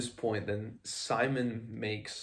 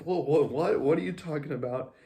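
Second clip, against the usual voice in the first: flustered outburst, higher and louder; mock freak-out; 5 semitones higher, 7 dB louder than usual. Simon answering a remark he misunderstood, freaking out. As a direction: act out a startled, rattled reaction. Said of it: "Simon kind of freaks out".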